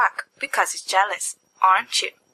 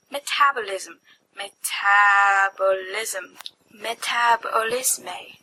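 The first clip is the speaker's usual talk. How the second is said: savouring the word; 3 semitones lower, slower than usual. Acted.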